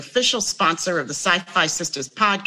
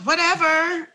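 dismissive pet voice; 9 semitones higher than usual. Pretend. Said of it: "My Tribble was just like".

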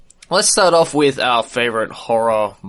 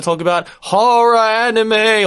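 spooky voice; 7 semitones higher, 3 dB louder, slower than usual. Pretend. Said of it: "the reason why we're talking like this".

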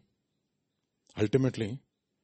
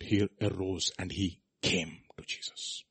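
slow, hushed whisper; whispered narration; 5 semitones lower, 8 dB quieter, slower than usual. Acted. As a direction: draw them in with a whisper.

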